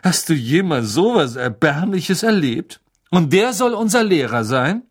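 loud, swooping sneer; höhnisch. A narrator's reading. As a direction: pour scorn on the teacher. Malfoy sneers at Hagrid. (verächtlich und höhnisch)

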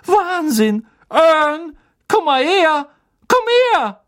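high, loud shouting to a mate; excited yell. A narrator's reading. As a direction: yell it out in amazement, high-pitched. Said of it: "schrie Stan".

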